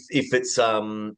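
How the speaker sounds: usual speaking voice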